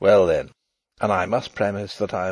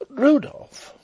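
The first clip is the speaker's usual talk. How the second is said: sharp, very high outcry; scandalised exclamation; 5 dB louder than usual. A narrator's reading. Sister-in-law reproves Rudolf, high scandalised exclamation. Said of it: "exclaimed my brother's wife".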